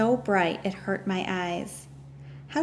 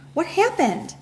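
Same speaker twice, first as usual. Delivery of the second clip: frightened; 9 semitones higher, 6 dB louder than usual. Acted. Girl runs to father, frightened.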